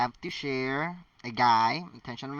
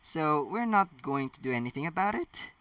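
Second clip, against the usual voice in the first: usual speaking voice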